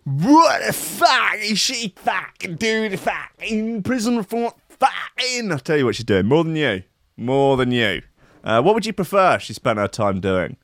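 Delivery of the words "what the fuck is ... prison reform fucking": high, drawn-out mock shout; exaggerated shouty rant; outraged heckler. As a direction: stretch it out in hammed-up outrage. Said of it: "see someone like".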